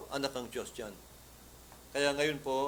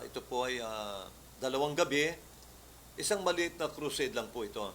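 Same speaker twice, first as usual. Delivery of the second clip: usual speaking voice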